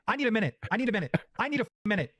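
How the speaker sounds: ordinary talk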